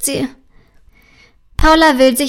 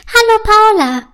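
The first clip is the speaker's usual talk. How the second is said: much higher and louder tiny fairy greeting; high friendly fairy voice; 10 semitones higher, 5 dB louder than usual. A narrator's reading. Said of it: "mit ihrer hohen, freundlichen Stimme". (hohe, freundliche Feenstimme)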